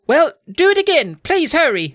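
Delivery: high, urgent pleading voice; begging. Acted. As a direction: beg in a squeaky child character voice. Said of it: "I begged him".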